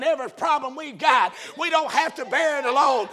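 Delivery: loud, driving sermon cadence; full-force preaching. Real, not acted. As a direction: preach it out to the whole church.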